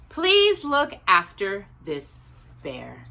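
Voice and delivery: plain reading voice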